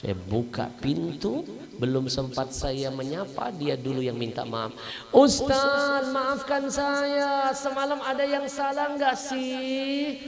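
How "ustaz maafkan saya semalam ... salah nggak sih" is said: high, loud, slow apologetic voice; anxious doctor. Acted. Doctor apologizes to the ustadz, high and anxious. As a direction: beg forgiveness nervously.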